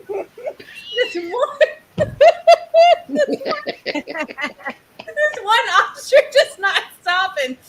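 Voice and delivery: helpless high giggling through the words; laughing. Real, not acted. Storyteller cracking up over one stubborn ostrich, laughing. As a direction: try to talk while cracking up.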